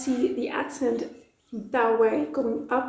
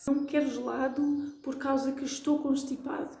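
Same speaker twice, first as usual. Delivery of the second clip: a hint of a primary school kid; child's mistake; 2 semitones higher, 4 dB quieter than usual. Mocking a young kid's wrong sentence, lightly voiced. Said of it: "something that you hear kids ... say".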